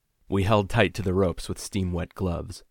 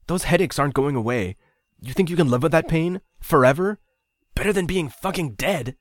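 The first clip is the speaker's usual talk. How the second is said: raised desperate argument; 10 semitones higher, 7 dB louder than usual. A narrator's reading. Ryan arguing hard.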